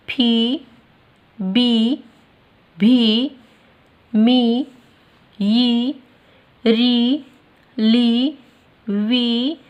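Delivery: each long-ee syllable called out loud and held; stressed syllable drill. Shown as a demonstration. Teacher drilling children on long ee syllables, loud and stretched. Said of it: "हमें यहाँ पे जोर दे के बोलना है".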